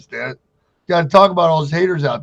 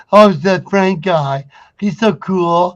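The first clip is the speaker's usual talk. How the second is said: higher, louder, slow gushing admirer; starstruck fan voice; 4 semitones higher, 6 dB louder, slower than usual. Retold or imitated. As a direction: gush like an awestruck student. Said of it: "and everybody gets like".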